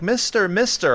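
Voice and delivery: put-on high, eager little-girl voice; mock little girl pleading; little girl begging. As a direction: call out like a small kid tugging at a stranger. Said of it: "and she's like".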